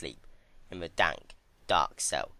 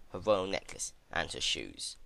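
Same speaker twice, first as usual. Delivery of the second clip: ordinary delivery, nothing marked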